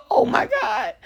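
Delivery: very high gasp of dismay; shocked. Retold or imitated. Mother hearing her toddler's answer, high horrified. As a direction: squeal it out in horror.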